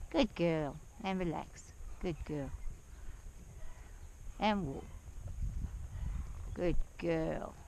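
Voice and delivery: low, soft and calming, to a nervous mare; soothing horse talk. Real, not acted. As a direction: settle the horse with a low, gentle voice.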